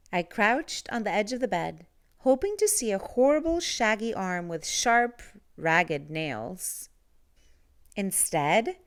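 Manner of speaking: ordinary delivery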